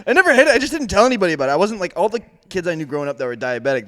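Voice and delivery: ordinary talk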